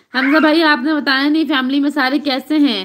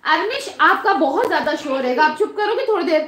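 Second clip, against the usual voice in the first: higher-pitched sharp telling-off away from the mic; 5 semitones higher than usual. Real, not acted. Mother hushes noisy kids mid-stream.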